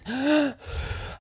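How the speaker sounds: high strangled gasp; mock strangled gasp. Shown as a demonstration. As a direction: choke on a breath. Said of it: "It's not quite".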